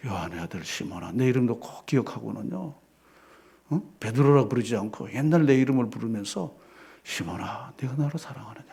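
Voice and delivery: low, soft voice sinking to a whisper; tender hushed Jesus. Acted. Jesus asking Peter if he loves him, low and hushed. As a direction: ask gently as Jesus would. Voicing Jesus again, softly.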